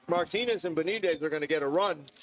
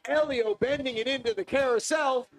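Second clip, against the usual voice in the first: motorsport commentator; 6 semitones higher than usual. Real, not acted. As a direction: narrate every move as it happens. Live race call.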